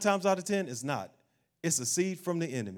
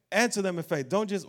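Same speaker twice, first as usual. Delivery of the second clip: ordinary talk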